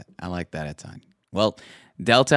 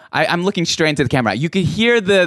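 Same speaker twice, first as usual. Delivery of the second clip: ordinary delivery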